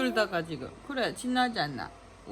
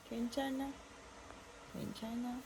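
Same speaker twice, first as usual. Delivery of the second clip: soft comforting; 15 dB quieter than usual. Real, not acted. Mother reassuring her crying baby, soft and comforting.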